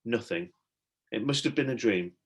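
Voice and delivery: ordinary delivery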